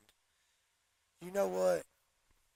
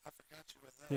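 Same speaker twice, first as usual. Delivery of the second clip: put-on Doc Holliday's Georgia accent; 8 dB quieter, much faster than usual. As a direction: imitate a soft-spoken Georgia gambler, quietly. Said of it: "Talks like he was from Savannah".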